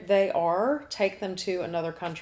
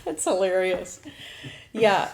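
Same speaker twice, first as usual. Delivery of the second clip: said through real laughter; laughing; 5 dB louder than usual. Real, not acted. Host to the room after the twirl, laughing. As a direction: still laughing while speaking.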